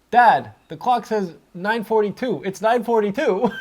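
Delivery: put-on excited kid. Kid telling his dad what the clock says, lightly mimicked child. Kid retold, announcing the time.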